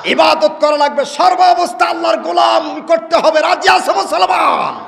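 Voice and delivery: high, loud rallying preacher; rousing call. Real, not acted. Preacher asks Muslims if they agree, rousing shout. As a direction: call out to the whole crowd.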